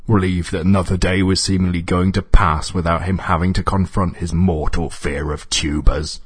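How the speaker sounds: ordinary delivery, nothing marked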